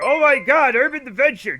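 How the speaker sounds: loud, thrilled burst at the raid; excited shout. Real, not acted. Excited reaction to incoming raid.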